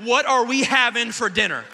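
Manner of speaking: hammed-up outraged demand; husband mock-demanding his wife tell him the truth, loud. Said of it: "I said".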